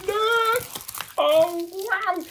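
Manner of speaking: thrilled oh wow as the painting comes out; delighted surprise. Real, not acted. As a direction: squeal it out like you just opened a present. Unboxing the diamond painting.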